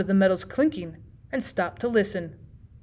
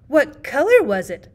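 put-on eager children; 4 semitones higher, 5 dB louder than usual. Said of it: "asked the children".

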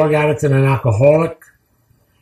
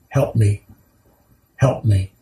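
soft, lower, pleading help me; quiet plea; 6 semitones lower, 3 dB quieter, slower than usual. Pretend. Preacher voicing a desperate plea to the congregation, hushed and pleading. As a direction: breathe it out like a quiet cry for help.

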